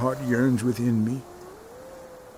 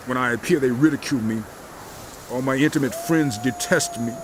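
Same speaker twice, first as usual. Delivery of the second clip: usual speaking voice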